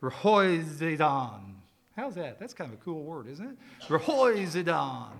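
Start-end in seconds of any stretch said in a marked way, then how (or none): 0.0-1.5 "Rehoizidan" drawn out slowly, with an audible H after the R; "Rehoizidan" as a slow pronunciation demo, breath on the H; pronouncing a Greek word. Shown as a demonstration. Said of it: "it sounds something like this". 3.9-5.1 "Rehoizidan" stretched out, with a breathy H after the R; slow demonstration of "Rehoizidan", H sounded; pronouncing a Greek word. Shown as a demonstration. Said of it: "it sounds something like this".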